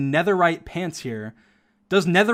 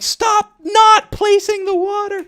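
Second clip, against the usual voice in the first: high, slow, yelled imitation of a pissed friend; mock angry yell; 5 dB louder, slower than usual. Imitating a pissed-off Sapnap yelling at his teammate. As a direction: shriek it out like an annoyed teammate. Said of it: "yelling, he's getting pissed".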